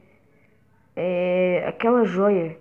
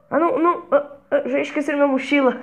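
panicked; 7 semitones higher, 6 dB louder, faster than usual. Acted. Realizes he forgot backpack.

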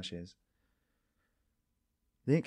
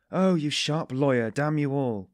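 loud, slow furious snap; angry snap; 4 semitones higher, 8 dB louder, slower than usual. A narrator's reading. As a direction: lash out at the sly lawyer. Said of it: "snapped Razumikin".

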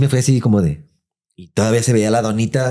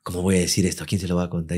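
much softer, lower muttering; hushed worry; 5 semitones lower, 7 dB quieter, faster than usual. Retold or imitated. Scene: narrator hides and worries to himself, hushed. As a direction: wonder quietly to yourself.

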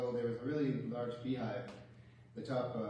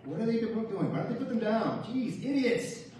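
much higher, louder frustrated yelling, slowing down; dad yelling at plows; 10 semitones higher, 6 dB louder, slower than usual. Retold or imitated. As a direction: rant at the snowplows like an exasperated dad. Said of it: "my dad would always yell".

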